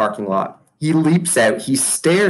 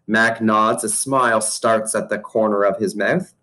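ordinary delivery

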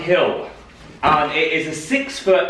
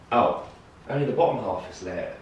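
usual speaking voice

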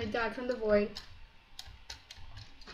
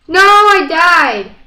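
frustrated shout; 5 semitones higher, 13 dB louder, slower than usual. Real, not acted. Dying in game.

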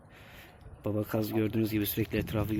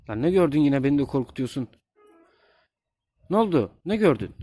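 high, louder startled calling; alarmed; 4 semitones higher, 6 dB louder than usual. Real, not acted. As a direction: ask in sudden alarm. Goslings alarmed, speaker startled too.

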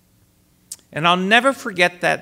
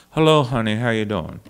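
Helen Hodem; 6 semitones lower, faster than usual. Retold or imitated. Singer mimicking the vocal coach, affectionate low impression. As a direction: imitate an elderly coach's greeting, dropping low. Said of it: "this is how she talked".

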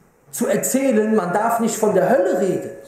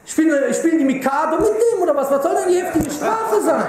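mocking laugh; 6 semitones higher than usual. Real, not acted. Mocking opponents' view of hell.

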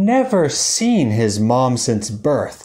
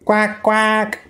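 squeaky rubber duck; 7 semitones higher than usual. Acted. Mother duck arrives quacking.

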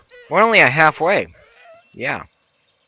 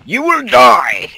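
loud, high, drawn-out threat; battle cry; 12 semitones higher, 11 dB louder, slower than usual. Pretend. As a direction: yell a slow threat at the enemy. Battle cry at game enemy.

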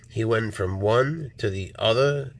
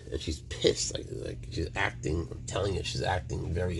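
whisper; 3 semitones lower, 6 dB quieter than usual. Real, not acted. Aside about snake.

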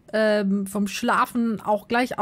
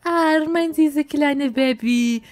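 mild cooing; 4 semitones higher, 5 dB louder, slower than usual. Acted. Cooing at the baby.

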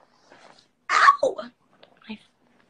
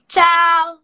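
a loud, high "Ciao" called out; sing-song goodbye; 8 semitones higher, 14 dB louder than usual. Real, not acted. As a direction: toss off a big cheery "Ciao".

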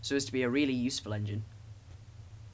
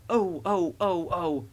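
higher, louder wail of protest; screaming; 4 semitones higher, 4 dB louder, much slower than usual. A narrator's reading. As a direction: cry out as startled trucks. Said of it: "they screamed as their couplings tightened".

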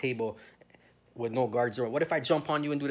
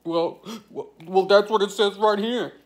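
much higher, louder prissy mock voice; mocking the agent; 7 semitones higher, 9 dB louder than usual. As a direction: whine it like a smug official pointing at a file. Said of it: "the bitch is like".

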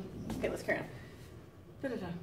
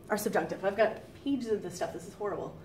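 normal talking voice

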